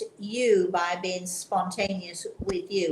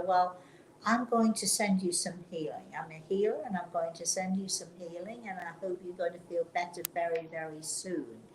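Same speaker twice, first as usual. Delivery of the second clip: quiet reassurance; 3 semitones lower, 7 dB quieter than usual. Retold or imitated. Comforting a distressed stranger.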